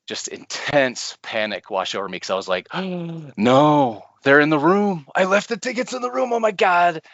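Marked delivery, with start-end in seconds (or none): 2.7-3.3 gasp. Retold or imitated. Panic realizing tickets left behind. 3.4-7.0 high, loud panicked blurting; replaying the moment she thought the tickets were lost. Retold panic over forgotten tickets.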